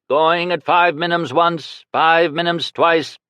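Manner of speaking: high, slow auctioneer drone; droning. A narrator's reading. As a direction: drone the bids out. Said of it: "The auctioneer started droning".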